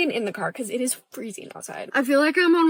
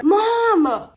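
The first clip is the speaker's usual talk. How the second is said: yelling; 10 semitones higher, 3 dB louder than usual. Acted. Teen yelling for mom.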